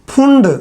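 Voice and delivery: "फुंड" with a short u vowel; preferred pronunciation. Shown as a demonstration. Teacher naming the series his own way, "फुंड" with u. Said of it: "मैं तो फुंड ही बोलता हूँ".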